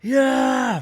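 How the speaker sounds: sudden high yelp of pain; shout. Retold or imitated. Stepping on Lego at night, a high pained yell. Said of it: "I shouted".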